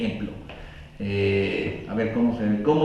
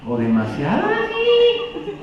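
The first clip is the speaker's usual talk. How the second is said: too high; 3 dB louder, slower than usual. Shown as a demonstration. Speaker demonstrating a voice that is too high, far higher and slow. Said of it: "el tono de tu voz es demasiado".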